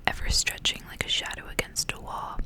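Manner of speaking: ordinary talk, nothing marked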